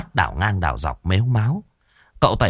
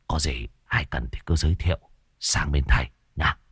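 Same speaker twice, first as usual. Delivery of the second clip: low, softer, wheedling instructions; sly old sorcerer; 7 semitones lower, 5 dB quieter than usual. Acted. Old sorcerer instructs the homeowner, low and wheedling. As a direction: coax the clients to keep the charm on. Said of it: "Lão dặn".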